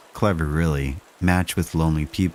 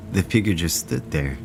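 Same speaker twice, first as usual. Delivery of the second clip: usual speaking voice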